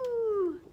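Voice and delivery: long sliding hoot; flamingo fluting. Shown as a demonstration. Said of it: "You can try that too".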